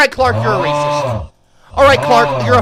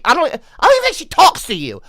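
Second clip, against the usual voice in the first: ordinary talk, nothing marked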